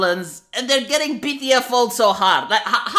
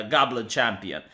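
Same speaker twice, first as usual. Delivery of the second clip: ordinary delivery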